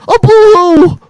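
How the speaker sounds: high, babyish mock whimper of poo-hoo. Host mocking the wrestler's sympathy plea in a babyish mock whimper. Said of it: "try to fuck".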